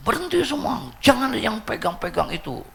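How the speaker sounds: high-pitched hammed-up yelling; imitated raging. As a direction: order everyone off in a mock frenzy.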